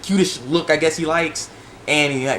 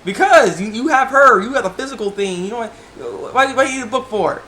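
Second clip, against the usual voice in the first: exasperated retort; 6 semitones higher than usual. Retold or imitated. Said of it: "I'm like".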